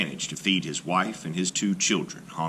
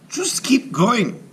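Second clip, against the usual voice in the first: impatient mother; 7 dB louder than usual. A narrator's reading. Mother scolds Hansel again, shrill and loud. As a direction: bark at the boy to move on.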